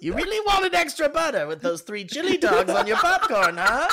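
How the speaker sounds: high, loud, laughing through the words; laughing tease. Pretend. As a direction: tease them while cracking up. Waiter voiced teasing customer.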